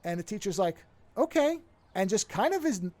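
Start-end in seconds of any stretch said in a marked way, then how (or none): 1.2-1.6 higher and louder, thrown; a startled, humoring okay; awkward okay. Retold or imitated. Teacher humoring a strange student, startled and pitched up.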